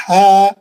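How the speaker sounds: tajweed ha demo; breathy ha. Shown as a demonstration. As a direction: sound ہا with clear breath. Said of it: "ہا ایک دم صحیح رہنا".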